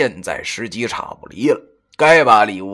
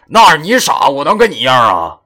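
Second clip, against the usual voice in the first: loud, mock-scornful comeback; wry inner retort; 5 semitones higher, 8 dB louder than usual. A narrator's reading. Silently answering the boasting friend, wry retort. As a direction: fire back ruefully in thought. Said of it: "我心中苦笑着".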